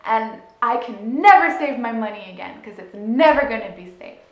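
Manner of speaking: loud, hurt accusing shout; angry child's outburst. Retold or imitated. Six-year-old accusing mom of stealing, angry yell. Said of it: "In my brain, it was".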